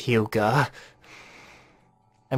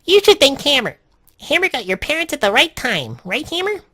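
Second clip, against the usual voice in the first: put-on very high, loud excited girl; high-pitched girl praising Hammer; girl's voice; 14 semitones higher, 6 dB louder than usual. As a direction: squeak it out brightly like a kid. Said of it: "I can't remember her voice".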